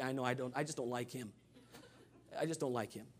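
usual speaking voice

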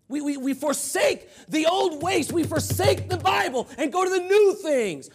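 loud, high pulpit shout; fired-up, insistent preacher; preaching at full force. Real, not acted. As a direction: thunder it at the congregation.

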